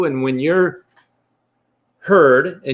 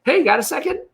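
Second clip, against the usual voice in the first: much higher, whiny mock pester; nagging repeat; 12 semitones higher, faster than usual. People grabbing for his time, higher mock nagging. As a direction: pester him again, pitch jumping way up. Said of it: "'cause everybody wants a piece of you".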